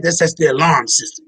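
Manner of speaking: ordinary talk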